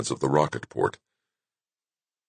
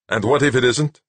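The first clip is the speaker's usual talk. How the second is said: sharp challenging question, louder; snapped; 9 semitones higher, 4 dB louder than usual. A narrator's reading. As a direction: bite back with the question. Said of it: "Montana snapped".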